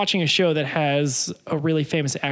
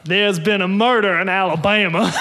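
louder, put-on Creole Alabama accent, too harsh; first try at a Creole accent, overdone; Creole accent attempt; 4 dB louder than usual. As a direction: hit the Creole accent hard. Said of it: "I would attempt to do his accent".

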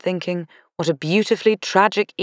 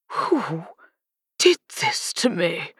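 a dead man's bewildered ghost; 2 semitones higher, slower than usual. A narrator's reading. Ghost of murdered duke speaks.

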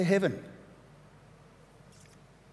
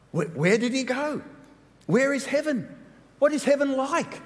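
higher-pitched, stammered wondering; baffled questioning; 5 semitones higher than usual. Acted. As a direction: act out puzzled disciples firing questions. Acting out the disciples' baffled questions.

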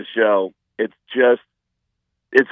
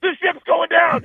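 high, louder panicked cry; mock panic; 3 dB louder than usual. Comedian mocking panicked fans, high louder cry. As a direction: shout it like the ship sinks. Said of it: "freak out and go".

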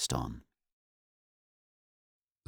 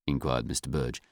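ordinary delivery, nothing marked

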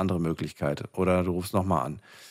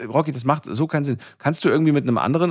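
raised, higher and quicker over a bad line; to someone hard of hearing; 6 semitones higher, 4 dB louder, faster than usual. Real, not acted. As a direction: push the words through a poor line. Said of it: "rufst du mit Handy". (laut und hoch gegen die schlechte Verbindung)

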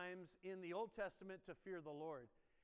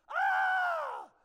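shrill imitated scream of a child; kid's scream; 18 dB louder than usual. Child shrieking at a tiny spider, loud scream. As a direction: scream like the kid who saw a spider.

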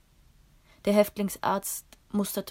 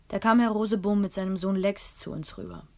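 usual speaking voice

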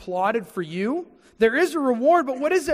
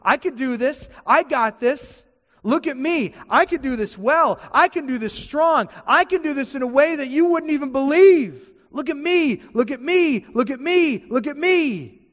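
loud, higher, bragging and showy; boastful voice of hypocrisy; 4 semitones higher, 5 dB louder than usual. Acted. Preacher voicing hypocrisy to the church, loud bragging. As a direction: puff up and brag, demanding to be watched. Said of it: "But hypocrisy says".

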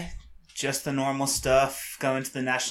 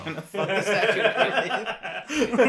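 high-pitched, laughing through the words; 8 semitones higher, slower than usual. Real, not acted. Guest riffing on the Statue of Liberty joke, laughing. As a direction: crack up while saying it. Joking amid group laughter.

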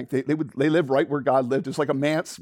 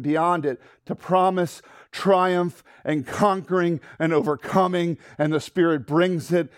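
raised, building preacher's proclamation; preaching; 3 semitones higher, 4 dB louder than usual. Real, not acted. Preacher urges the congregation to be Samuels, ringing proclamation. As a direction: drive the climax home, stretching key words.

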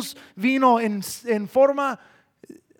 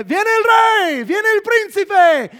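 herald's shouted announcement; 9 semitones higher, 6 dB louder than usual. Acted. Heralds going ahead of the prince, shouting for homage. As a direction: proclaim it over a whole crowd, stretching the words. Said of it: "gente que iba por delante de ellos diciendo".

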